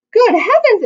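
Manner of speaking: high, loud startled cry; a loud storybook gasp of surprise; exclaiming. A narrator's reading. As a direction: burst out in shock. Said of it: "exclaimed Bob".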